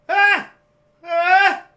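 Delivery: high, very loud "Ah!"; imitated excited scream. Dad screaming downstairs at the game, full-voiced yell. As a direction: re-enact a scream heard from another room.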